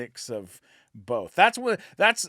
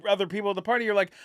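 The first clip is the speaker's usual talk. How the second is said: ordinary talk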